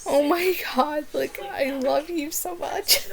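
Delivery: high, soft fangirl gush; swooning over a favourite character; retold gushing. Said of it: "and I was just like".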